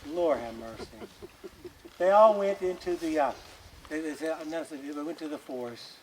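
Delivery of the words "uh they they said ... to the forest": ordinary delivery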